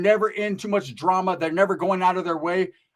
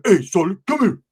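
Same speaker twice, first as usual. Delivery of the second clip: lightly put-on Uso accent, louder; 6 dB louder than usual. Uso calling over the wine maker, Uso accent. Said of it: "he had that little accent".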